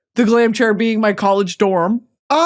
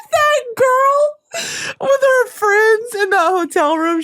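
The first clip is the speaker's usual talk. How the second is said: high, slow, cry-laughing; 12 semitones higher, slower than usual. Real, not acted. Recalling the hotel room video, laughing. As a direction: squeal it through helpless laughter.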